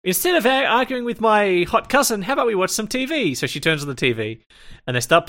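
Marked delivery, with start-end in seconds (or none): 0.0-3.3 high-pitched girl singer impression; Minmay. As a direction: pitch it way up, mock-sweet suggestion. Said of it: "and then Minmay's like".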